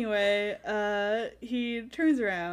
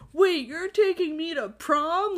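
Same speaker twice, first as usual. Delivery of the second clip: higher, louder, drawn-out disbelief; stunned realization; 7 semitones higher, 5 dB louder than usual. Retold or imitated. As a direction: act out slow-dawning shock at the news. Voicing Bella's stunned realisation.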